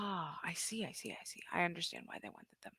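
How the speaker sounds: whispered realization; muttering under breath. Acted.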